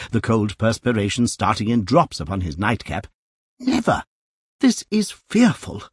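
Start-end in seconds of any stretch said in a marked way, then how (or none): 3.6-5.8 much higher, appalled little cry; horrified gasp. A narrator's reading. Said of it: "thought poor Mister Pickwick".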